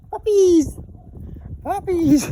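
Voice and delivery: loud high call to the pets. Real, not acted. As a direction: call them in from across the garden. Calling the pets in.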